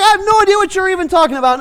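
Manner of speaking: high, loud and fast; agitated denial. Retold or imitated. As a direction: brush them off in a panic. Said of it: "He's like".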